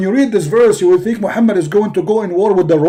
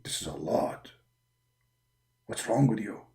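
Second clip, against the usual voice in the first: deep, low whisper from above; Allah's voice; 13 dB quieter than usual. Acted. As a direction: whisper it like a hidden god. Allah replies.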